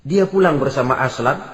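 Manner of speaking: normal talking voice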